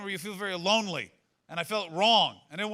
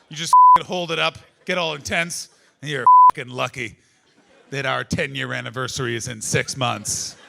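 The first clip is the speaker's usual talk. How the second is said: mock threat; 4 semitones lower, 9 dB louder than usual. Comic acting out wife's anniversary threat.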